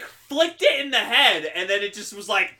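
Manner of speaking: normal talking voice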